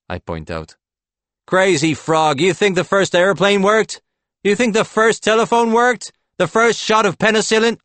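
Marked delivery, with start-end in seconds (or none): 1.5-7.8 high, loud, incredulous rant; heated retort. A narrator's reading. Howie scoffing at Claude's doubts, heated and loud. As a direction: fire back with mounting disbelief.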